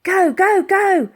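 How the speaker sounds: high loud urging; calling out. Acted. Elsie urging the gang across the ice, loud. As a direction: urge everyone on. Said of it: "called out Elsie".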